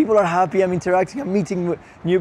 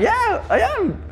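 proud yes; slower than usual. Retold or imitated. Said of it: "And I'm like".

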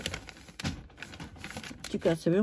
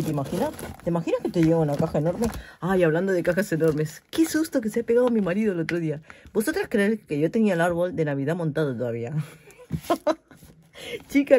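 ordinary talk

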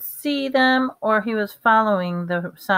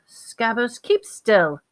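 higher-pitched hissed command; urgent hiss; 4 semitones higher, slower than usual. A narrator's reading. Ron to his wriggling rat under the cloak, hissing. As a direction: hiss it at a squirming pet. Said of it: "Ron hissed".

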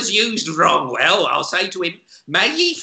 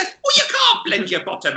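high, quick, puzzled voice; high baffled shop assistant; 12 semitones higher than usual. Retold or imitated. Assistant questions the customer, high and baffled. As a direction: ask it squeaky and bewildered.

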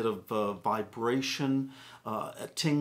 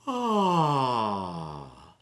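yawning sigh; 2 semitones lower, 4 dB louder than usual. Shown as a demonstration. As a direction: open yawn sigh on ah. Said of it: "We'll go".

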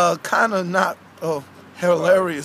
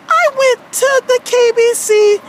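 earnest puppet character, higher than the host; character storytelling voice; aggrieved Wendy; 13 semitones higher, 4 dB louder than usual. Acted. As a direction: recount it as a wronged cartoon girl. Plays puppet Wendy telling story.

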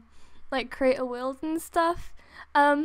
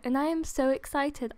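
ordinary talk, nothing marked

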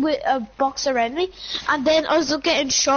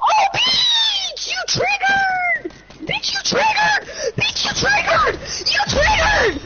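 high furious yelling; raging gamer; slower than usual. Real, not acted. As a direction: scream at the enemy player. Raging after being killed.

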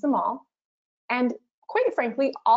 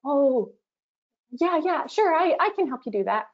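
high, meek and eager to please; passive; 6 semitones higher than usual. Shown as a demonstration. To a friend asking for moving help, a meek passive yes. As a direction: give in with a forced bright yes. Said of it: "Passive is simply".

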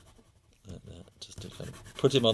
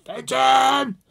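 loud, high yell after a runaway dog; irate owner yelling; 11 dB louder than usual. Retold or imitated. Owner yelling for his runaway dog, loud high shout. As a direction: bellow the dog's name across the park. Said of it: "Fenton's irate owner going".

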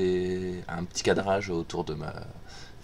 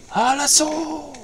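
high, slow drawn-out charge cry; rally the troops in a squeaky high voice; mock battle cry; 5 dB louder, much slower than usual. Gamer charging his ball forward with a high battle cry.

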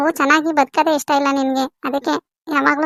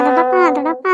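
calling out. Acted. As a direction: call after uncle as he walks away. Daughter calls out to departing uncle.

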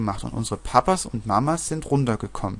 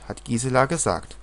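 plain reading voice

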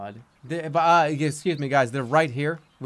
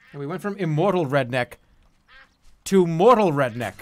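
ordinary delivery

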